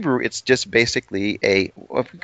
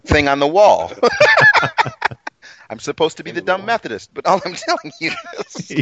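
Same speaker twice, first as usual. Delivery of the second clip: laughing through the words, high pitched; talking through real laughter; 8 semitones higher than usual. Real, not acted. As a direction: giggle at his own joke. Laughs at himself.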